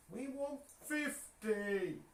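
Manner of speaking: high, drawn-out call to the viewers; slow rising holler; playful demand. Real, not acted. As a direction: call it out long and high, rallying the crowd.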